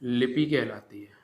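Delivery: slow, spaced dictation for notes; dictating to students. Acted. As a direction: read it out word by word for writing down. Dictating definition slowly.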